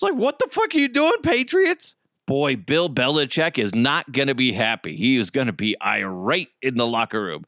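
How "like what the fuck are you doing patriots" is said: high-pitched, incredulous outburst; mock exasperation. Fan yelling at the Patriots over a botched play, mock exasperation. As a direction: fire it off fast in mock disbelief.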